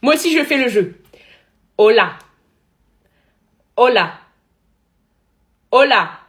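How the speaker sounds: very loud, punched-out greetings; cris de jeu. Real, not acted. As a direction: shout each word at the game. Shouting greetings at a game. (crier très fort, mot par mot)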